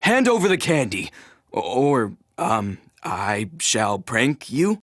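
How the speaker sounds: unsure, stumbling attempt at menace; hesitant threat. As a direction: demand that falters into uncertainty. Halting threat while demanding candy.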